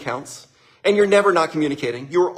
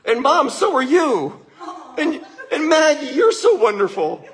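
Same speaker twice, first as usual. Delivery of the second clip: high, slow, laughing through the words; giggling; 11 semitones higher, 4 dB louder, slower than usual. Retold or imitated. Retelling his daughter's praise, laughing throughout. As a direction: giggle it out like a delighted toddler.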